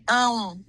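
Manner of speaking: "um" as a nasal sound that closes at the end; nasal Portuguese ending. Shown as a demonstration. Teacher shows a student the ending of João, nasal sound. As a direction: draw out "um" through the nose, closing the lips late. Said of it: "You make the nasal sound".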